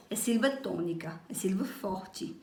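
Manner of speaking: dropping to a near whisper; quiet explanatory aside to students; soft aside. Real, not acted. Teacher to class, murmuring the definition softly.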